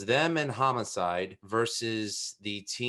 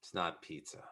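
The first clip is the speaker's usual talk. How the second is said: low, quiet verdict after a pause; understated disgust; 5 semitones lower, 11 dB quieter than usual. Pretend. New Yorker triggered by Chicago pizza, low quiet objection. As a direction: say it low and much softer, as a grudging correction.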